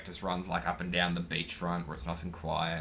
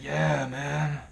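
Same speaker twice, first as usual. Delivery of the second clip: higher-pitched put-on voice of another bloke; injured guy retelling; 6 semitones higher than usual. Injured guy explaining his scooter crash, imitated in a higher voice. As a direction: mimic a battered guy telling his woes. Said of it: "And he's like".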